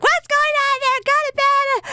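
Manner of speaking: very high-pitched imitated yell; mock yelling. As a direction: mimic someone hollering at noisy kids at bedtime. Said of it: "and then we're screaming".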